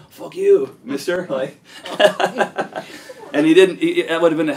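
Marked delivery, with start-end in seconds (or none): 0.1-1.3 high, loud fake bravado; belting a cheeky insult at a gunman; defiant shout. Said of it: "I'm like an idiot going". 1.7-3.3 breathless, high cackle; real wheezy laugh bursting out; high wheezing laugh. Real, not acted. Laughing at near-death memory.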